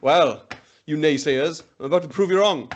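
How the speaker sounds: normal talking voice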